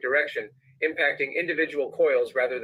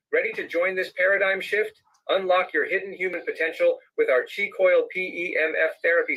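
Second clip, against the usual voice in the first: ad read; 4 semitones higher than usual. Acted. Switches into product pitch.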